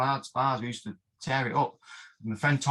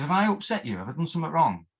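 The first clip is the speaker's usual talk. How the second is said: anxious plea; 5 semitones higher, 3 dB louder, faster than usual. Retold or imitated. Asking sparring partner if upset.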